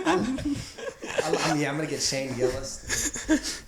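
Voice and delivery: laughing through the words. Real, not acted. Joking about getting canceled while laughing. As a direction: talk while cracking up.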